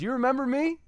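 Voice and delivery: mock the driver he has caught; smug cop. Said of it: "looks down at him and goes".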